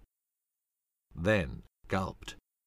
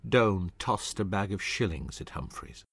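plain reading voice

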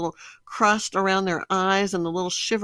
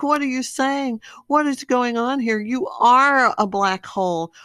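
exasperated disbelief; 5 semitones higher, 5 dB louder than usual. Retold or imitated. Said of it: "And I'm like".

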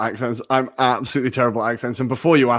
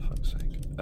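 muttered curse; 10 dB quieter than usual. Real, not acted. Frustrated by a wrong quiz answer.

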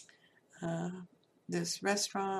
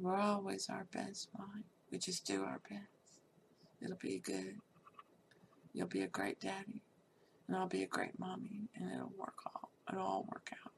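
soft reassurance; 7 dB quieter than usual. Retold or imitated. Reassuring husband over newborn.

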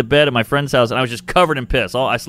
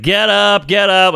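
angry mom; 7 semitones higher, 3 dB louder, slower than usual. Retold or imitated. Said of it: "Like, she was pissed".

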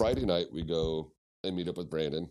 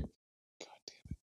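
slow, much softer, voiceless; mock exasperated mutter; muttered curse; 16 dB quieter, much slower than usual. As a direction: groan it under the breath. Wife groans at order.

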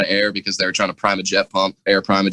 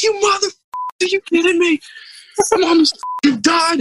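much higher, yelling in outrage; furious yell. Retold or imitated. Diver yelling at deck crew after losing air, furious. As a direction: scream at the crew who cut your air. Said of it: "I was like".

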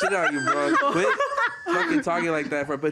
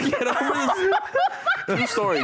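laughing; 12 semitones higher than usual. Real, not acted. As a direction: laugh while saying it. Friend retelling story again.

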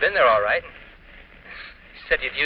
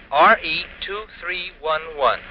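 letter by letter, slow and careful; slow spelling; 5 semitones higher, much slower than usual. Acted. Husband spelling the number, slow and deliberate. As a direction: spell out every letter and digit slowly. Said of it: "Got it?".

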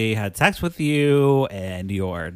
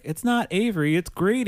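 1 mock indignant correction; 9 semitones higher than usual. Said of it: "And he's like".

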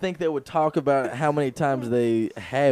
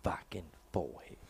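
soft, low whispered aside; 6 semitones lower, 16 dB quieter than usual. Acted. Reacting under the commentary in a whisper. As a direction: drop to a whisper.

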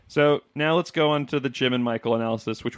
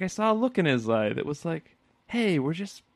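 lightly voiced, higher Michael recalling Ryan; a hint of a higher, needy Michael; imitating Michael; 7 semitones higher than usual. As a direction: play him as a giddy, deluded boss. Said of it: "he's like".

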